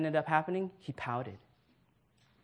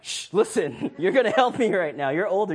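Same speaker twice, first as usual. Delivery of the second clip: loud, quick, laughing hush; laughing plea; 3 semitones higher, 7 dB louder than usual. Retold or imitated. Uncle laughing as he begs the older niece for help. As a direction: hush her while cracking up.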